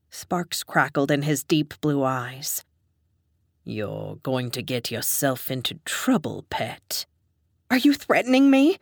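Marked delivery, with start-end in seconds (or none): 3.6-7.0 low warning. A narrator's reading. Dom warning the narrator, low and soft. Male character low, fading. 7.7-8.8 higher, louder and fast; demanding. A narrator's reading. Narrator confronting the dom, a high fast demand. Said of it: "I demanded".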